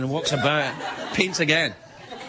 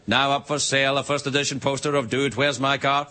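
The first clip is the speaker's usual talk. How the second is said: low level auctioneer chant; 3 semitones lower than usual. Acted. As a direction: announce the lot in an auctioneer's monotone. Acted auction sale of a poster.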